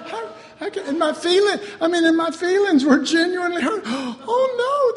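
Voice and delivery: high, loud, laughing mock whine; mock hurt. Preacher mocking his wounded younger self, high laughing whine. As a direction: whine about hurt feelings while laughing.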